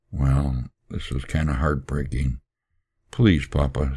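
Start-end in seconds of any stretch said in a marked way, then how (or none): none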